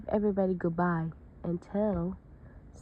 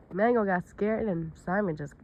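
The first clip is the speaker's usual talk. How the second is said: ordinary delivery, nothing marked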